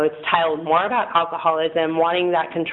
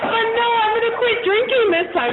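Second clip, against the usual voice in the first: mock protest. Retelling her own reply to mom.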